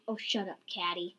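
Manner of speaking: ordinary delivery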